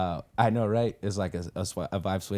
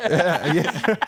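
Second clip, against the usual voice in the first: laughing; 7 semitones higher, 6 dB louder than usual. Real, not acted. Laughing agreement.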